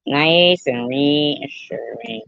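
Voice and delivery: slow, stretched-out repetition; drawn-out echo. Real, not acted. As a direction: linger on each word. Echoes her own words, drawn out.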